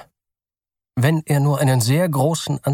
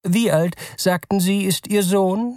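higher curious salesman voice; curious clerk; 9 semitones higher than usual. Acted. Clerk asks the father his son's age, curious and high. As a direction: ask it with a clerk's prying interest. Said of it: "fragte der Verkäufer neugierig".

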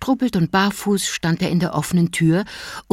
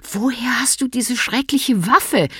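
higher, sharp mother's rebuke; scolding mother; 7 semitones higher than usual. A narrator's reading. Mother scolding her son about the toy gun, high and sharp. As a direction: snap at the child in a high voice. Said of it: "herrschte sie ihren Sohn an".

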